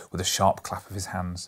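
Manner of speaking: ordinary delivery, nothing marked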